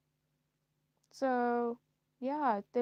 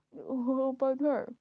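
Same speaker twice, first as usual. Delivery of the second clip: mock suitor; 5 semitones higher, 6 dB louder, slower than usual. Voicing men asking about her.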